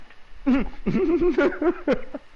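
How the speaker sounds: high, loud giggling burst; laughing. Real, not acted. Amused by the teller's mistake.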